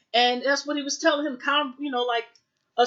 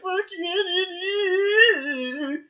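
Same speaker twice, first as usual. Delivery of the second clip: crying shout; 8 semitones higher than usual. Retold or imitated. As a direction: yell it through tears. Son cursing again, crying.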